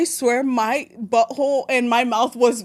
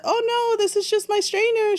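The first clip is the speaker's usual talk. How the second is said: much higher, airy "Oh, no" covering up; feigned innocence; 10 semitones higher than usual. Retold or imitated. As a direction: play it off so mom drops it. Hiding pregnancy from mom.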